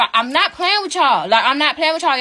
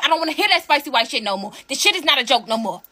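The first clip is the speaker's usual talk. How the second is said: usual speaking voice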